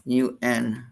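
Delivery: ordinary delivery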